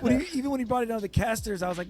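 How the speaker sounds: ordinary talk, nothing marked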